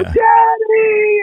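imitating small children shouting Daddy; excited kid shout. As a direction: yell it like a little kid running to dad. Kids running at airport.

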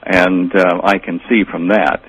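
normal talking voice

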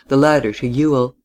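plain reading voice

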